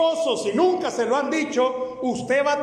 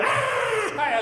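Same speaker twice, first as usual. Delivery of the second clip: short high mock shriek; a quick high squeal like the wife; mock fan shriek; imitated wife's scream; 12 semitones higher than usual. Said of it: "cuando ella".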